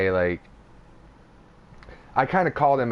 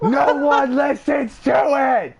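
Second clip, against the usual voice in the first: high, loud, slow yell; screamed; 7 dB louder, slower than usual. Retold or imitated. He screams at Justin about the podcast, high and loud. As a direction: scream it at full force. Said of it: "I fucking screamed it at him".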